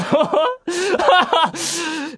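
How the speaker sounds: high burst of laughter; laughing at his own mistake. Real, not acted. (自分の失敗に笑う)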